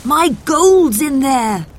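high, loud, drawn-out wail of alarm; shouting. A narrator's reading. Baker whose gold is inside the fire, shouting in upset. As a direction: cry it out slowly, distraught. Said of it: "he shouted".

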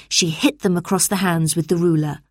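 plain reading voice

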